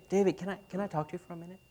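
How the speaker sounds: very soft, hurried, discreet request; hushed, tentative and quick; private aside. Acted. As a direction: ask quietly, as if nobody else should hear. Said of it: "excuse me, in private".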